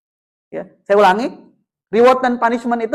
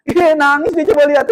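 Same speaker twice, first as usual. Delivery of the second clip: higher, teasing jeer; playful imitation of public humiliation; mock shaming; 3 semitones higher, 3 dB louder than usual. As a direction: imitate showing up a crying child. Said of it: "memalukan depan teman-temannya".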